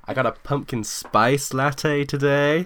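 put-on slow American Valley Girl drawl with creak; creaky Valley Girl American accent; Valley Girl vocal fry. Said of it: "full American Valley Girl vocal fry".

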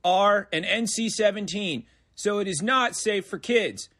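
mock broadcast disclaimer read; deadpan rating announcement; announcer voice.

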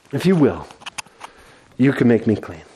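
quiet desperate plea. Acted. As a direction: whisper the hope again, broken. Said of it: "the desperation in his heart and his voice".

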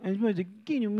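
sulky mock kid mumble; mumbling kid. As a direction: imitate a child's garbled whine. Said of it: "when kids talk like that".

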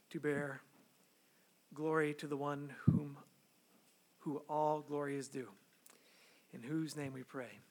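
hushed pastoral prayer before preaching; public prayer. Real, not acted. Leads the congregation in prayer.

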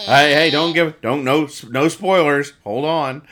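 loud, half-laughing no spoilers; playful protest. Real, not acted. Host stopping cohost from spoiling the comic, loud playful protest.

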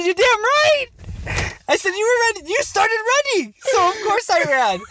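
high hammed-up own younger self; cocky retort. Retold cocky reply to dad.

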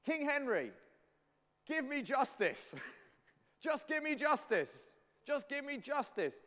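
high, loud hammed-up demand; demanding prisoner. William in the tower demanding justice from King Henry, high shout. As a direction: call up to the king, pitched high and insistent. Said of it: "if he had said".

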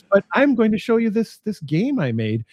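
ordinary talk

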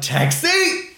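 high excited yell; yelled call. Retold or imitated. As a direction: call out for a cab. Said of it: "And so I yelled".

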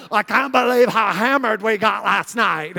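put-on drawled hungover party-girl voice; mock a college girl bragging about last night; exaggerated ditzy girl impression; annoying college girls. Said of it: "they're just talking like this".

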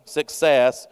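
ordinary talk

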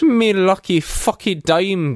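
hammed-up Scottish brogue, starting high; Scottish accent. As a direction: play it as an excitable Scottish character. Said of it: "in your best Scottish accent".

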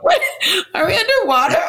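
high laughing disbelief; laughing confused question. Retold or imitated. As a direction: giggle it out in confusion. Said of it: "I was like".

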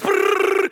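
high, loud mock engine sputter; playful motor noise for the crowd; imitated sputtering motor. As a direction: buzz your lips like a dead outboard. Stalled boat motor imitated.

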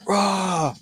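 high, drawn-out mock roar; over-the-top enthusiasm. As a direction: roar it like an overexcited narrator. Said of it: "[name] carries that brilliantly".

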